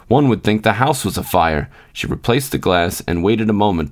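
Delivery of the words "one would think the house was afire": ordinary delivery, nothing marked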